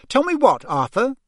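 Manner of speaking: high, loud demand; suspicious wife. A narrator's reading. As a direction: press the husband for an answer. Suspicious wife questions her husband.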